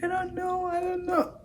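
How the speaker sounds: high, small whimpering voice; mock crying. Crying victim answering the nurse, whimpering. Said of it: "I was just crying".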